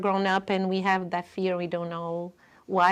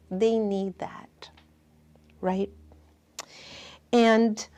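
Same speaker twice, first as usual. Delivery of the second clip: ordinary delivery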